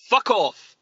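put-on "Fuck off" barked high and loud in a Scottish accent; Scottish "Fuck off", punchy and loud; Billy Connolly's Scottish. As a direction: spit out "Fuck off" like a Glaswegian. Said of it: "Like that".

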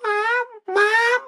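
mock a whiny little boy calling mom; whiny kid voice. Mocking the scared kid calling his mom in a high whine.

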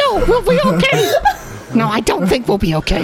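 high, mock-tearful goblin wail; panicked goblin. Game master as doomed goblin couple, high mock panic. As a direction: fret in a comically high voice.

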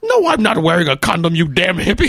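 loud, high, terrible Connery impression; hammed-up indignant refusal in a bad Scottish star voice; Sean Connery. Retelling a friend's bit, outraged bad Connery. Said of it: "worst Sean Connery impression".

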